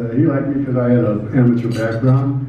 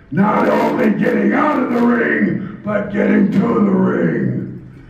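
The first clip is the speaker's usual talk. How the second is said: drawn-out wrestling promo in German character; Baron Von Raschke; 5 semitones higher than usual. Acted. Wrestler bragging of riots at his matches, slow character promo. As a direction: declaim it slowly, higher than natural. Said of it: "I went from little Jimmy Rashke from Nebraska".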